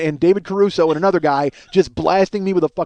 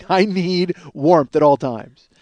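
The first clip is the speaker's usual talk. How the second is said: drawn-out plea; much slower than usual. Pretend. Pleading cold man mock.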